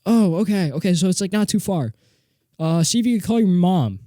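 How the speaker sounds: high, gentle helpful voice; a neighbor soothing the child; kindly neighbor. Retold or imitated. Neighbor reassures the kid and has him call mom, high and kind. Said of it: "And then she was like".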